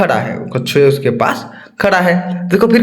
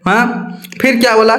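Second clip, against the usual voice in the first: plain reading voice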